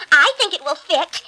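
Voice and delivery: high, loud hopeful boast; eager stepsister. A narrator's reading. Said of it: "the stepsisters tried their luck".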